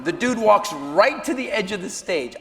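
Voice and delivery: normal talking voice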